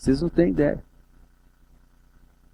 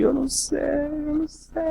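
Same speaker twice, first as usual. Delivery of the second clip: put-on high, soft, tearful boy; crying child; 13 semitones higher, 7 dB quieter than usual. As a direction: sob it out like a lost child. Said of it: "E chorando também".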